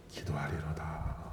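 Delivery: 기도하리로다 breathed out in a slow whisper; soft, slow whisper of 기도하리로다 as a prayer. Acted. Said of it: "하고".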